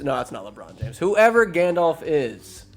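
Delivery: normal talking voice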